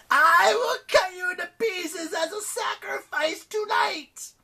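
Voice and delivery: loud, high, slow monster screech; furious, drawn-out villain howl; growling goddess of misery. A narrator's reading. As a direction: shriek the threat, dragging out each word. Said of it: "she growled".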